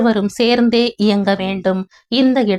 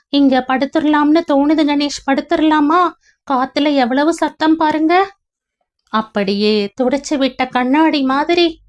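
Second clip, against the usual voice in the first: higher, dreamy female voice; young woman Leena voiced; 6 semitones higher than usual. Leena recalling a past life to Ganesh, in a higher woman's voice. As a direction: voice Leena as she drifts back to a half-remembered past.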